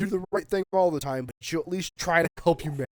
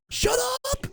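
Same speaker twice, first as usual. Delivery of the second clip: "Shut" yelled much higher, rising at the end; full shout. Real, not acted. Vlogger screams at politicians, furious shout. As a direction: scream it at them, losing patience.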